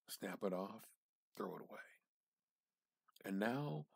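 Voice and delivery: usual speaking voice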